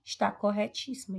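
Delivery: ordinary talk, nothing marked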